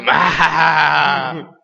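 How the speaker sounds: slow, drawn-out evil laugh; evil villain cackle. Retold or imitated. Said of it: "me habla por teléfono Mario".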